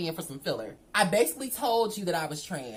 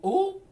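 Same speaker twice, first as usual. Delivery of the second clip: high ooh; 12 semitones higher, 7 dB louder than usual. Real, not acted. Reacting to his line.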